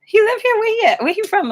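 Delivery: high, loud prying aunt; rapid-fire nosy questions, way up high; aunt quizzing. Retold or imitated. Imitating her aunt quizzing her about the guy, high and loud. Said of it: "She was like".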